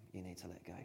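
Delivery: hushed, gentle urging; soft repeat. Shown as a demonstration. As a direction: say it gently, almost to yourself. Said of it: "repeated softer".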